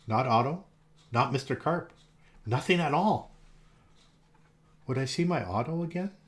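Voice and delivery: plain reading voice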